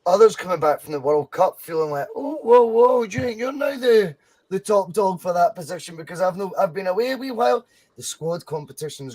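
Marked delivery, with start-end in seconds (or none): 2.1-5.9 mock indignation. Mocking returning World Cup player.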